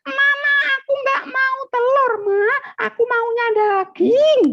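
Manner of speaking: very high-pitched mock tantrum; hammed-up child's shouted whine; angry child, acted. Ustadz mimics child refusing mama's egg dish, angry high shout. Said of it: "tidak marah-marah sama ayah bundanya".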